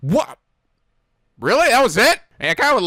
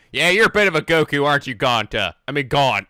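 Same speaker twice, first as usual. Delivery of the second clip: ordinary talk, nothing marked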